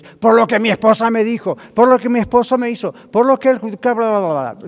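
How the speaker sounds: loud, high, rushed ranting; agitated blaming. Acted. Discouraged person's mind blaming the spouse, ranting. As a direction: rattle off grievances into blah-blah.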